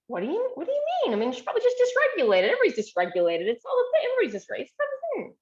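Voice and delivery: high and rushed, tumbling reassurance; quick reassurance. Retold or imitated. Therapist hurries to reassure child, high and rapid. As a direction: brush the worry away fast and bright.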